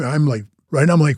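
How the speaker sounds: ordinary talk, nothing marked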